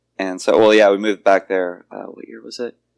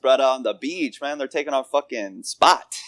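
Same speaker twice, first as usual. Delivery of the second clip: higher mock Hawaiian Pidgin surfer; mock Hawaiian local; 5 semitones higher than usual. Joking about Hawaiian locals and tourists, put-on Pidgin accent. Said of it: "that was racist".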